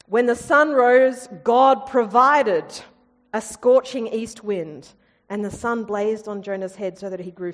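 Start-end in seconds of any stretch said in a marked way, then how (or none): 1.4-2.7 God provided, slow and louder; slow emphatic. A narrator's reading. Repeat of the refrain.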